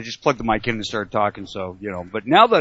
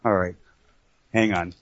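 ordinary delivery

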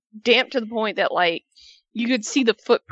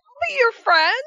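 very high, louder mock-eager voice; imitating an eager friend; 5 dB louder than usual. Podcaster acting out a wrestler welcoming Bayley, high and eager. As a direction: offer it eagerly, pitched way up. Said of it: "He's like".

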